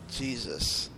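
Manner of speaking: ordinary talk, nothing marked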